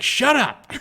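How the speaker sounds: high, loud mock snap; angry snap. Caller snapping at the radio host, mock angry. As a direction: bark it at the host.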